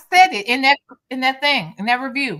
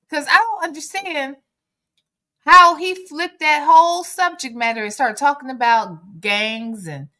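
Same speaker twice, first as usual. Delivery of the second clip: ordinary talk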